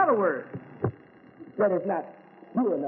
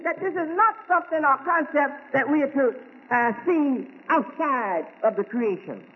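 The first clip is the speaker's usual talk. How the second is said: higher, raised preaching voice; 9 semitones higher than usual. Acted. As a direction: lift it up and preach to the congregation. Preaching again at height.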